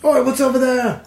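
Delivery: high ogre call. Acted. Ogre hears the clanking armor, high and calling. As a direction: shout it like a suspicious brute. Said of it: "one of the ogres says".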